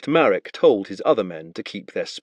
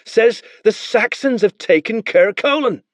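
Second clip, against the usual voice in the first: high, amazed simple soldier; wide-eyed spearman; 10 semitones higher, 3 dB louder than usual. Acted. Spearman tells Marek what the boy claims, amazed. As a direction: report it half believing.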